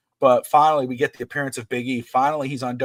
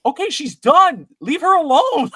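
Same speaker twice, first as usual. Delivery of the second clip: imitating upset daughter; 8 dB louder than usual. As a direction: plead loudly in a daughter's high voice. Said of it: "but she's like".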